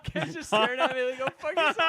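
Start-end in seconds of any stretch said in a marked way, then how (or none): 1.2-1.8 mock angry retort. Acted quote of Ken's stare.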